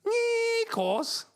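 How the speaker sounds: high Scouse voice stretching out Nikos; Steven Gerrard impression. Streamer doing the Liverpool captain's catchphrase, mock Scouse. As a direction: mimic a footballer's catchphrase in a thick Scouse accent. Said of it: "Every time he's in an interview post-match".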